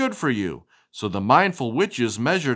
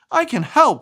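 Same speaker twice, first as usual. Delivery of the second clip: eager offer; 9 semitones higher, 6 dB louder than usual. A narrator's reading. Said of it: "she said".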